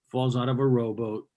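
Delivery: ordinary talk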